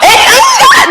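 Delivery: ordinary delivery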